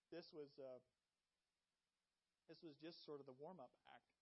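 ordinary delivery

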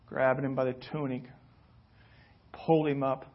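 ordinary talk

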